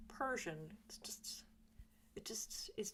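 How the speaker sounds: quiet unvoiced whisper; hushed whisper about how the word feels. Real, not acted.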